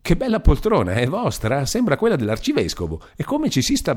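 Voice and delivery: breezy visitor making small talk; lively Beatrice. A narrator's reading.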